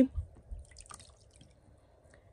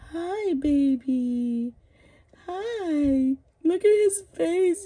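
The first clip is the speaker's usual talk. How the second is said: rising, higher cooing greeting; 7 semitones higher, slower than usual. Real, not acted. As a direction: talk to the doll like a real infant. Greeting the baby doll.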